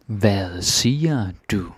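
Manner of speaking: "Hvad siger du?" said slowly, each word apart; slow teaching repeat. Shown as a demonstration. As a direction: model it slowly for learners.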